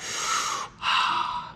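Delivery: long forceful breath out; breathing exhale. Shown as a demonstration. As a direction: blow out a deep Wim Hof breath. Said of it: "sort of like that".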